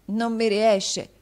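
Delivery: put-on a boy who can't learn his lesson, dejected; sad disappointment. A narrator's reading. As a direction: say it slowly, defeated. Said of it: "con triste delusione".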